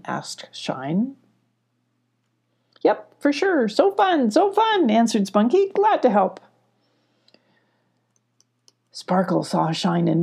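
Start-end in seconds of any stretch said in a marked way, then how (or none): 2.8-4.9 much higher, excited puppy voice; bubbly Spunky. Acted. Spunky happily agreeing to help, high and excited. 5.7-6.4 much higher, excited puppy voice; bubbly Spunky. Acted. Spunky happily agreeing to help, high and excited.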